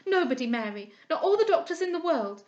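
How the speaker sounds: high, loud tearful pledge; girl clinging to a dying friend; anguished devotion. A narrator's reading. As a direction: swear never to leave her side.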